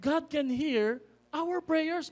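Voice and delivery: calm the child down, lightly voiced; a light hint of gentle mother. Mother gently correcting her child. (mahinahong boses ng nanay)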